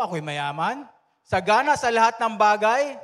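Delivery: mock boast. Pastor voices the boastful church.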